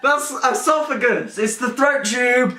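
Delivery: high excited cheering; triumphant. Real, not acted. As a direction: celebrate finding the answer. Triumph at finding answer.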